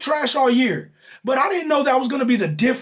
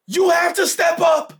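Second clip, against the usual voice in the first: shouting; 8 semitones higher than usual. Real, not acted. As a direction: yell it at the team. Ranting, calling out the defense.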